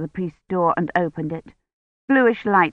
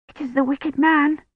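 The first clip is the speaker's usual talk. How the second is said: put-on high, frightened child's voice; hysterical fear; 9 semitones higher than usual. A narrator's reading. Child warns of the man at the door, hysterical. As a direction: panic in a small, high voice. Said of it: "she whispered hysterically".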